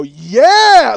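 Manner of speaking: loud gloating "Yeah!"; triumphant yell. Retold or imitated. Gloating at the other driver, a triumphant yell. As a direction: crow over the wreck. Triumphant shout after merging.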